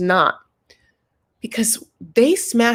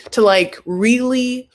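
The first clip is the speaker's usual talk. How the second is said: normal talking voice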